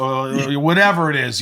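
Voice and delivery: ordinary talk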